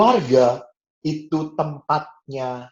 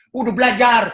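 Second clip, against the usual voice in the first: bossy order; 12 semitones higher, 7 dB louder than usual. Retold or imitated. Narrator tells siblings to study.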